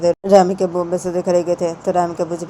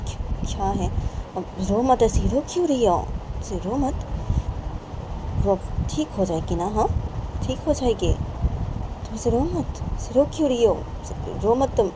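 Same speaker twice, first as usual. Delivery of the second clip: higher, softer voiced father; father comforting; 5 semitones higher, 4 dB quieter than usual. Father soothing his crying wife, higher and softer. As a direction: gently hush her tears.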